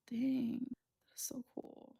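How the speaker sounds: soft whispered so cool; barely voiced awe; awed whisper. Real, not acted. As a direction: breathe it with wonder.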